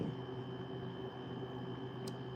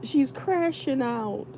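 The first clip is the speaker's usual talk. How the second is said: mocking other people; 8 semitones higher, slower than usual. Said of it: "they'll be like".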